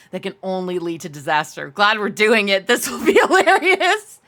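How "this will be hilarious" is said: laughing. Real, not acted. Laughing at the upcoming challenge.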